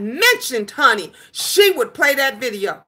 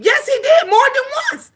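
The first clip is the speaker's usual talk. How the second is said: excited yes; 12 semitones higher, faster than usual. Real, not acted. Streamer confirming to chat he shouted her out, excited. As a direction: burst out confirming it.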